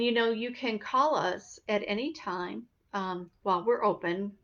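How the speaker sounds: ordinary talk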